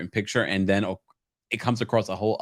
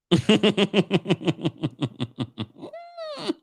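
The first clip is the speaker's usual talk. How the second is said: long high laugh; 10 semitones higher than usual. Real, not acted. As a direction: burst out laughing. Host laughs at viewer comment.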